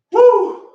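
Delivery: high, loud "woo" of relief; sharp high post-workout woo; winded whoop. Real, not acted. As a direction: whoop out a big breath after exertion. Blows out after hard kettlebell set.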